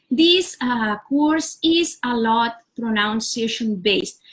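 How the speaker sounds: ordinary delivery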